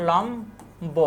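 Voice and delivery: লম্ব split in two, the b clearly sounded; unaltered b. Shown as a demonstration. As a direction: say লম্ব softly as "lom... bo". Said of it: "এভাবে হবে উচ্চারণ".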